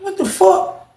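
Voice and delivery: stunned disbelief. Retold or imitated. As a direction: pitch it up in total shock. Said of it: "I'm like".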